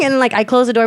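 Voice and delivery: ordinary talk, nothing marked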